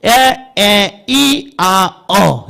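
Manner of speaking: 에, 에, 이, 아, 어 said as five separate short vowels; teacher modelling 에, 에, 이, 아, 어 as distinct beats; vowel sounds demo. Shown as a demonstration. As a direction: sound out 에, 에, 이, 아, 어 one by one. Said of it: "어떻게 발음이냐면".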